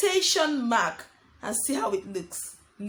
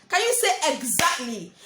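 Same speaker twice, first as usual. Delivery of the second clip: ordinary talk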